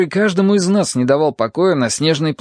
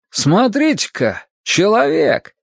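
high, drawn-out joyful surprise; радостное удивление; 6 semitones higher, slower than usual. A narrator's reading. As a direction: call out in happy amazement, stretching the words. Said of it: "сказал радостно и удивлённо". (радостно и удивлённо)

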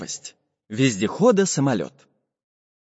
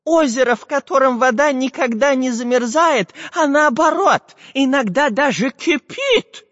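too much caffeine; 12 semitones higher, 3 dB louder than usual. Acted. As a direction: build the wonder in a high voice. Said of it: "взволнованно подтвердил".

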